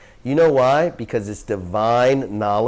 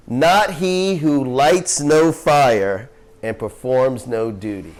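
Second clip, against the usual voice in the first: slow, raised, solemn recitation; scripture reading; 5 semitones higher, 3 dB louder, slower than usual. Acted. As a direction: intone the verse like holy text. Reads scripture aloud slowly.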